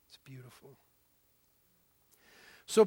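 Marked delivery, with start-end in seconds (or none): 0.1-0.8 soft breathy whisper. Real, not acted. Preacher telling the congregation their voice is beautiful, whispered. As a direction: lean in and whisper it.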